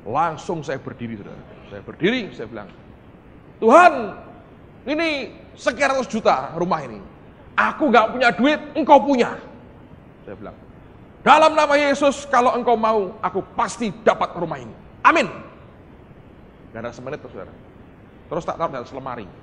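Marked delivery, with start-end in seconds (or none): 3.6-9.3 loud, high-pitched plea to God; raised-voice declaration in prayer; bold prayer cry. Retold or imitated. 11.2-15.3 loud, high start easing toward usual pitch; forceful prayer declaration, starting at full voice; declaring prayer. Retold or imitated. Declaring prayer in Jesus' name.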